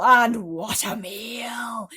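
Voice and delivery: slow, hushed, greedy whisper. A narrator's reading. Aunt gloats over the peach as a meal, whispering. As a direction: whisper it, licking your lips.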